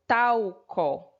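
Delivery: "talco" lento, partido em tal... co; separação silábica. Shown as a demonstration. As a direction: stretch the first syllable of "talco", then pause before the last. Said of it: "colocamos um L depois da primeira sílaba". ("talco" escandido, com a primeira sílaba alongada)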